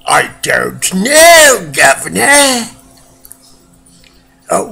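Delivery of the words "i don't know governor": put-on Cockney accent. Puts on British bloke voice.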